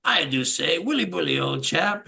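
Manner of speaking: lightly hammed English gent with lilting emphasis; a hint of a jaunty Englishman; mock Englishman with gusto. Imitating a bandmate's record intro, mock English gusto. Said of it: "you did it with gusto".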